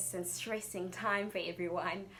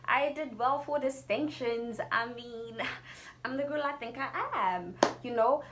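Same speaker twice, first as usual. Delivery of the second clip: self-assured; 5 semitones higher, 3 dB louder than usual. Retold or imitated. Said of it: "But I was like".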